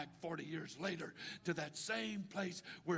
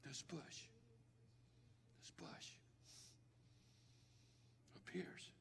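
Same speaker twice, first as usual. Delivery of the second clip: hushed; 16 dB quieter than usual. Acted. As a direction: drop to a near whisper. Hushed dramatic buildup.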